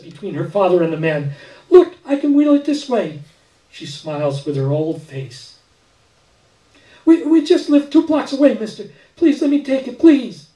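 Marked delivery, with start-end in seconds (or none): 1.7-3.2 put-on high, loud, eager child voice; a girl proudly showing she can do it; excited little girl. A narrator's reading. 7.0-10.5 put-on high, loud, begging child voice; a girl desperately begging a stranger; pleading little girl. A narrator's reading. Girl begs the man.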